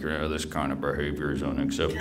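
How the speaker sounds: low, quiet, quick grumble; grumbling principal. Retold or imitated. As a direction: imitate a mean principal grumbling under his breath. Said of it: "kinda grumbled at me".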